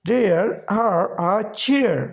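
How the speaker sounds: "there are a chair" drawn out very slowly, falling on "chair"; very slow model phrase. Shown as a demonstration. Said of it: "तो इस तरह से there are a chair". ("there are a chair" बहुत धीरे, "chair" पर गिरती आवाज़ में)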